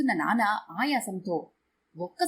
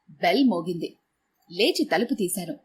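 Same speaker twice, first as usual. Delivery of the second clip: plain reading voice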